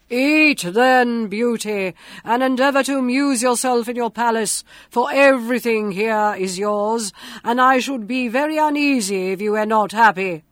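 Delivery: loud, booming beast voice; grotesque monster voice. A narrator's reading. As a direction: boom it out like a monster. The monster speaks.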